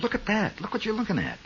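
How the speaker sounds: high, soft marvelling to oneself; quiet high-pitched awe at what's seen; hushed amazement. Retold or imitated. Man to himself watching a rare old film, hushed amazement.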